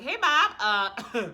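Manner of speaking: loud, high-pitched hail, then hesitating; mock call-out. Retelling hitting up Bob about the article, mock call-out. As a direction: call him out loudly and awkwardly.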